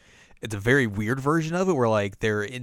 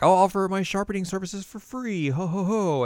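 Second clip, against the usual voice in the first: disguised villain's offer; 8 semitones higher than usual. Retold or imitated. Disguised villain's fake friendly offer.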